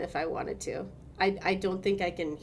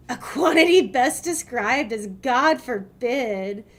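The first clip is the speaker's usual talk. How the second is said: dry, slow read; 5 semitones higher, 8 dB louder, much slower than usual. Acted. Reading a game line aloud.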